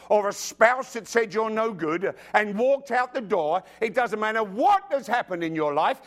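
rising, driving sermon climax; impassioned preaching. Real, not acted.

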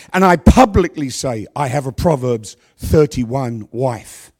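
ordinary talk

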